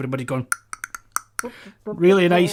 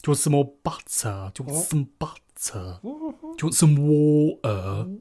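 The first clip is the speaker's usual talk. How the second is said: put-on English accent; 2 semitones lower than usual. Shown as a demonstration. Pupil trying received pronunciation out on Scotty, put-on English accent. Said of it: "Say this list of words ... in the accent".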